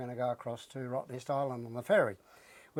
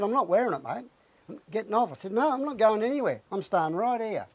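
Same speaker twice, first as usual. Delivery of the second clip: raised, higher defiant retort; refusing the ferry worker, raised and defiant; 6 semitones higher than usual. Retold or imitated. Retold standoff with ferry worker.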